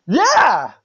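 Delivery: loud, high-pitched cheer; excited exclamation. Real, not acted. Exclaims over friend's delight at autograph.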